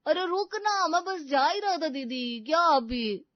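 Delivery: high, loud flustered protest; startled outburst. Acted. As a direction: blurt it out, caught off guard. Startled, caught off guard.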